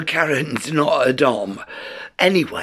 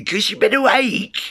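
ordinary talk, nothing marked

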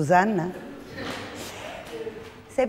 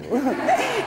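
short laugh; 9 semitones higher than usual. Real, not acted. Teacher laughs.

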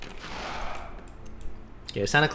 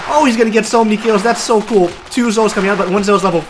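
hyped-up shout over the action; excited; 8 semitones higher, 6 dB louder than usual. Real, not acted. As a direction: call the kills with rising excitement. Excited play-by-play of a big fight.

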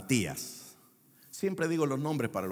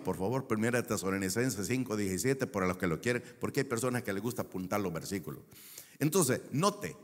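ordinary talk

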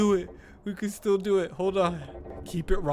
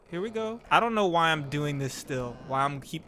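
ordinary talk

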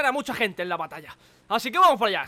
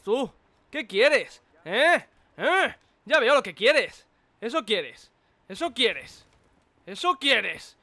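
normal talking voice

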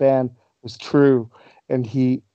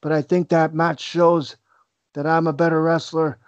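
slightly raised, put-on wrestler promo voice; Owen Hart; 3 semitones higher than usual. Wrestler addressing the rival who just beat him, impersonated voice. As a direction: impersonate a wrestler griping while claiming to be gracious. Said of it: "impersonation before we wrap up here".

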